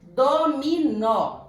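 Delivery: "Dominó" slow, loud and high in do-mi-NÓ; "Dominó" loudly sounded out in three long beats; syllable by syllable. Shown as a demonstration. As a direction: stretch out each syllable of "Dominó". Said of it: "A sílaba tônica é o nó".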